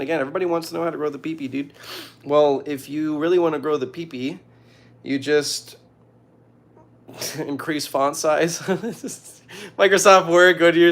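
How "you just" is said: ordinary talk, nothing marked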